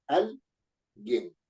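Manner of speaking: "Alguien" split into al, pause, guien; syllable by syllable. Shown as a demonstration. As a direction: break "Alguien" in two with a clear gap after AL. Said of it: "estoy ante una palabra grave".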